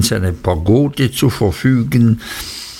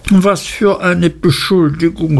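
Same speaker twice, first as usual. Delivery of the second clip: understated indignant cry, higher pitch; horrified exclamation; 6 semitones higher than usual. A narrator's reading. Wife appalled at husband's accusation, mildly horrified outcry. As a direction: exclaim it as the affronted wife, lightly acted. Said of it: "rief Dora aus und sah ganz entsetzt drein".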